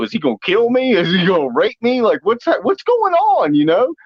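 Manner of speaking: put-on high, rising panicky girl voice; mock frightened. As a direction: play her rising panic, pitch climbing. Kaylee's imagined fear voiced.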